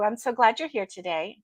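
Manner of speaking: ordinary talk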